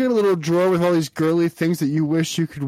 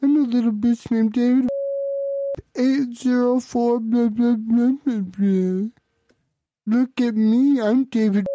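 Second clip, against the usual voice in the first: higher-pitched whiny mocking impression; David; 4 semitones higher, slower than usual. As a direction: ridicule the caller with drawn-out baby-like nonsense. Mocking David in first person.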